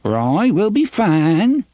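put-on high, slow quavering old man; quavery voice. A narrator's reading. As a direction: reassure in a shaky old voice. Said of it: "Jonas said in his quavery voice".

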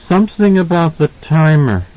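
put-on high child's voice, little real mutter; muttering boy. A narrator's reading. Danny answers his father, muttering little boy. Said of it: "Danny muttered".

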